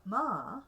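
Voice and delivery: "ma" swooping up then down; loud "ma" arched up and back down; rising-falling tone. Shown as a demonstration. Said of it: "rising and falling".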